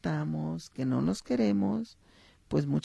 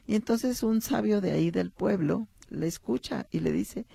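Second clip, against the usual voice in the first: usual speaking voice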